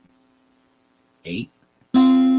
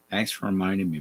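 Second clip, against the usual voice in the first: ordinary talk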